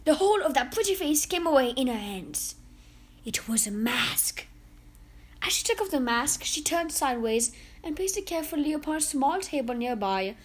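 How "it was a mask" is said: hushed whisper. A narrator's reading. Reveal of the mask.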